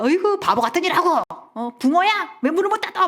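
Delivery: high, scornful belittling; mock contempt. Father belittling mother at home, high-pitched scorn. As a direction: imitate someone putting down their spouse. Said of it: "경멸과 무시하세요".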